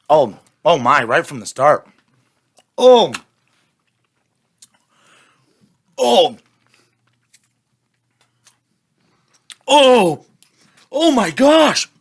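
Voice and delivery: gasping at extreme sourness; pained cries while sucking candy; eating sour candy. Real, not acted. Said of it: "I'm soaked in sweat right now".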